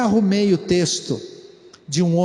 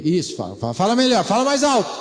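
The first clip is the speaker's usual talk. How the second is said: mock father demanding; much faster than usual. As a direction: push the kid to speak up. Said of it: "Parece pai ensinando filho".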